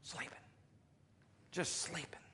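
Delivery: soft whisper; hushed whisper. Acted. Preacher picturing Peter asleep, in a whisper. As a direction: whisper so as not to wake him.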